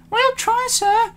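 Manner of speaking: very high, eager-to-oblige engine voices; engines answering. A narrator's reading. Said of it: "said the engines".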